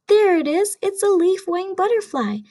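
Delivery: excited reveal. Acted. Showing children the hidden butterfly, excited. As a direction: announce it like a prize.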